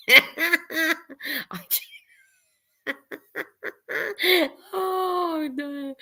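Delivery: high, cracked-up laughing speech; words swallowed up by a giggling fit; helpless real laughter. Real, not acted. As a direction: laugh too hard to get the words out. Cracked up by a comment.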